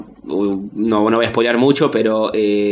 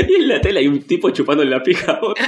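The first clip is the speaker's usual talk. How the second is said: laughing; 6 semitones higher, faster than usual. Real, not acted. Laughing while telling punchline.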